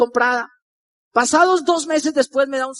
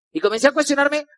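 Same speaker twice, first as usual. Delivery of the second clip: ordinary talk, nothing marked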